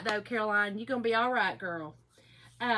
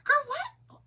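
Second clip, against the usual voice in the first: put-on girl what, pitched way up in disbelief; high self-scolding squeak. Talking to herself on her replay, high disbelief. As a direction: squeal it at yourself, high and baffled. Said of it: "sometimes I go".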